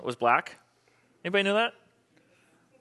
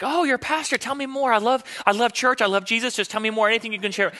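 gushing, high, eager believer voice, lightly voiced; eager churchgoer; 6 semitones higher than usual. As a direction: mimic a keen fan of church, lightly. Eager churchgoer meeting a pastor.

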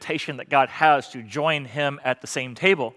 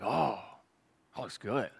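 hushed craving murmur; 7 semitones lower, 15 dB quieter than usual. Retold or imitated.

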